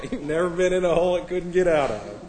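chuckling through the words; laughing. Real, not acted. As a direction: giggle as you repeat the punchline. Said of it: "I'll try and read this without laughing".

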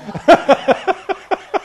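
a high, real laugh bursting out; high burst of laughter. Real, not acted. Preacher laughing at a little girl's punchline, high burst.

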